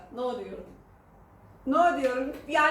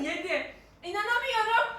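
ordinary talk, nothing marked

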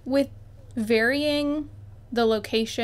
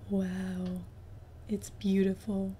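soft, low admiring wow; hushed awe; 4 semitones lower, 7 dB quieter, slower than usual. Real, not acted. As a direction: drop to a low, awed hush. Quiet repeat of the wow.

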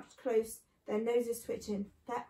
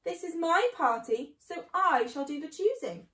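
raised, emphatic ordering; commanding; 5 semitones higher, 5 dB louder than usual. A narrator's reading. Mister Fox holds back the others, commanding. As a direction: take charge in a higher, firm voice. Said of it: "ordered Mister Fox".

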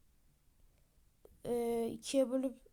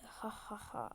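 evil whispered laugh; 3 semitones lower, 10 dB quieter, slower than usual. Acted. Villain's quiet evil laugh.

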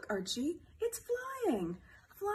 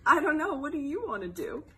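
ordinary talk, nothing marked

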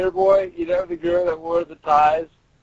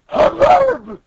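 high, loud burst of imitated commotion; mock hubbub noise; 4 dB louder than usual. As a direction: cry it out as a noise. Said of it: "the guy makes a noise like".

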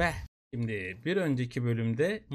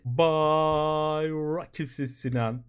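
drawn-out showman announcement; slow boastful showman intro; slow grand self-intro; 3 dB louder, much slower than usual. Pretend. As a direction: announce yourself like a star. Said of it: "Ben karizmanın ta kendisi".

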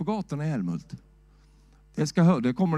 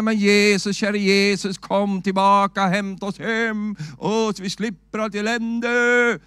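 mock weary prayer; 6 semitones higher, 5 dB louder, slower than usual. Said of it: "att säga så här".

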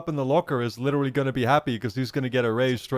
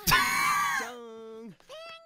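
shrieking laugh; 4 dB quieter than usual. Real, not acted. Streamer laughing at the cheating line.

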